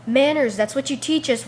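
loud, emphatic comeback; indignant retort. Retold or imitated. Student lecturing the teacher on manners, indignant.

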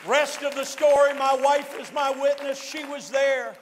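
loud, driving testimony delivery; rousing preaching. Real, not acted. Preacher tells congregation the convert's outcome, rousing full-force preaching. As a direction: build the crowd up with a triumphant testimony.

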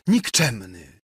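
mildly exclaimed condemnation; outraged cry. A narrator's reading. Jadwiga condemns Ksawery before fainting, mild outraged cry. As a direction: fling the insult with restrained outrage. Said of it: "zawołała".

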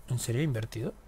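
ordinary talk

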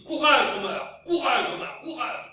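high, loud calls of courage; rally Omar theatrically; mock rallying cry. Narrator cheers the doomed gardener, loud mock rallying cry.

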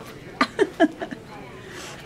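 soft high airy giggle; real giggle before the surgery; breathy giggle. Real, not acted.

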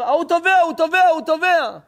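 high, loud urgent repeated cry; alarmed shouting. Acted. Bystander at the pool shouting that the boy is drowning. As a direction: raise the alarm, pointing at the water.

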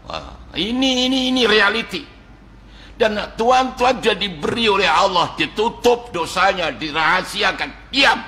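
high, impassioned preacher's voice; fervent preaching. Acted. Preacher to his congregation about hidden sins, impassioned and high. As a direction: lift it up and preach it hard. Preacher rises to full force.

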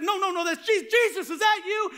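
high, urgent questioning cry; acted excited recognition. Preacher as disciple calling to Jesus on water, excited. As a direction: interrupt the panic with startled hope.

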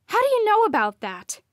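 loud, high demand. Acted. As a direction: demand an answer sharply. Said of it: "I demanded".

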